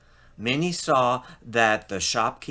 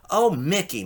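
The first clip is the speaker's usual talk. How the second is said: cried out; 6 semitones higher, 4 dB louder than usual. Acted. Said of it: "Minnie cried".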